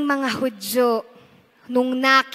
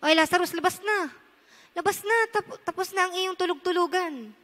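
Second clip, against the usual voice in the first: much softer, offhand wake-up; quiet playful nudge; casual soft call; 3 semitones higher, 7 dB quieter than usual. Retold or imitated. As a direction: tease a sleeper gently awake. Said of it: "Pwede naman niya sabihin".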